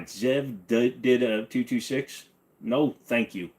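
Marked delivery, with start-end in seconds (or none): none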